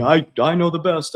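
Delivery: high, loud, smug know-it-all voice; mocking his own ego. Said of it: "I'm on my soapbox".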